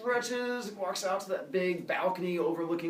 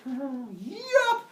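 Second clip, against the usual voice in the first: 1 cheeky; 12 semitones higher, 5 dB louder than usual. Acted. Prometheus cheeky again.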